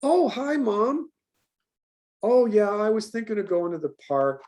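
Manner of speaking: high, sing-song pretend call to mom; bright fake-casual phone voice; fake phone chat. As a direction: put on a cheery voice for the dog's benefit. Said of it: "she's like".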